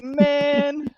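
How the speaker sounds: high put-on whine; dismayed groan. Rider answers his buddy, high and dismayed. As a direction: whine it, deflated.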